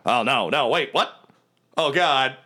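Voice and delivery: hammed-up panic, rising as it goes; panicked victim. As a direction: realize with dread what's happening. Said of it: "and he's like".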